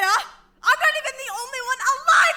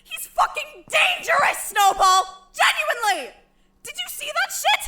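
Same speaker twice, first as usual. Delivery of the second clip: angry shouting; 7 semitones higher than usual. Acted. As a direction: hammer home how dangerous he is. Insisting angrily on the danger.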